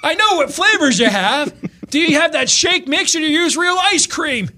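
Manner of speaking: high, loud exasperated yell; angry customer yelling at a fast-food clerk; ticked-off customer. Retold or imitated. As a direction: snap at the clueless clerk. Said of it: "he's like".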